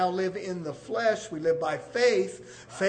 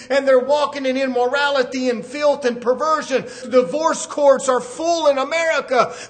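forceful, urgent sermon; preaching; 4 semitones higher, 5 dB louder than usual. Real, not acted. Preacher condemning divorce in America, forceful preaching. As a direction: hammer the warning at the congregation.

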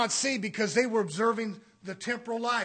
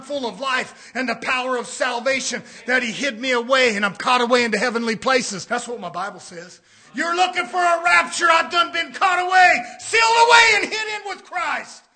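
very loud sermon shout, rising high; triumphant pulpit cry; full-force preaching; 3 semitones higher, 13 dB louder than usual. Real, not acted. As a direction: belt it out to the congregation.

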